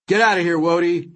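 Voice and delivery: commanding officer's urgent call; urgent order. A narrator's reading. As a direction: order them out, firmly and high. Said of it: "my father ordered".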